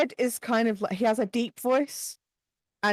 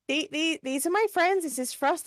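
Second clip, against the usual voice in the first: higher-pitched, keen introductions; eager Pearl; 8 semitones higher than usual. Acted. Pearl introduces her friends to the mayor, eagerly. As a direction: present each friend in a raised, lilting voice.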